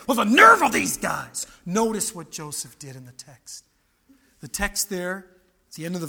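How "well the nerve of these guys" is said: loud, affronted outburst; indignant, louder retort; mock indignation. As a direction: act out someone outraged at the cheek. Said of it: "He didn't want to say".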